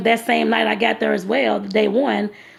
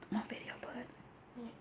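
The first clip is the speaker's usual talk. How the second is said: hushed aside; 27 dB quieter, slower than usual. Real, not acted. Mom whispering to her son off to the side, hushed. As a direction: whisper so the camera won't catch it.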